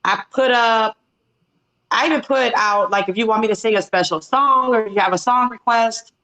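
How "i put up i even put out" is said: ordinary delivery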